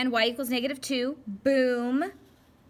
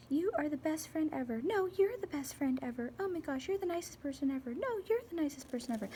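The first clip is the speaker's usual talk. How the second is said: higher, softer, speeding up as they out-compliment each other; two sugary friends trading compliments, quicker each time; gushing best friends; 7 semitones higher, 4 dB quieter than usual. Pretend. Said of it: "that's what their conversations are like".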